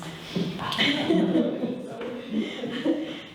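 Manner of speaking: laugh. Real, not acted. Laughs before telling a joke.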